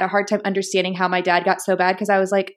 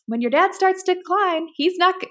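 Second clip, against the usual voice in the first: a lightly voiced mom, pitched much higher; imitating mom; 8 semitones higher than usual. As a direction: play mom's knowing warning, higher. Daughter voices her mom's warning.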